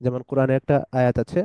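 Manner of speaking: usual speaking voice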